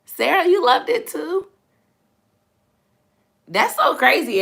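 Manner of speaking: high, loud and delighted; light up at Sarah's comment; excited. Real, not acted. Thrilled reply to a viewer's comment, high and loud.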